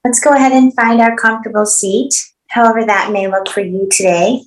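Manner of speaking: calm, unhurried meditation guidance with long pauses; spacious guiding voice, pausing between instructions; guided meditation. Acted. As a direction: settle them gently into stillness, phrase by phrase. Leads opening meditation.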